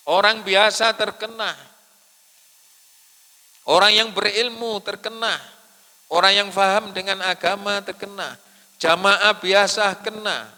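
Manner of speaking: loud, high-pitched sermon list climbing in intensity; full-force preaching. Real, not acted. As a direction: hammer out each item to the congregation. (khotbah berapi-api, lantang dan tinggi)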